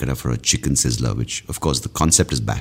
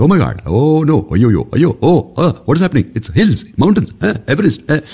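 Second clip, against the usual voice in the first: much higher and louder, imitating a panicked mother; panicking mom; 13 semitones higher, 6 dB louder than usual. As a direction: shriek it like a terrified mom on a landing. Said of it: "my mom next to me was just like".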